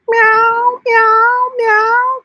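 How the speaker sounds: high squeaky meow; cat meows. Acted. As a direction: mew like a kitten. Greeting the cats.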